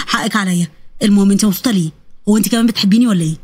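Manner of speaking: low, curious suitor; apologetic young man. Acted. (شاب بيسأل حبيبته بصوت واطي)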